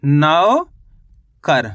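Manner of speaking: नौकर split in two, long rising नौ, gap, then falling कर; teacher slowly sounding out नौकर in two parts; syllable by syllable. Shown as a demonstration. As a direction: draw out नौ upward, pause, then drop on कर. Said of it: "तो मिलके बन गया अपने".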